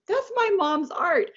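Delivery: high-pitched retold boast from a son; son's proud exclamation. Son telling people about the gift bags, proud exclamation.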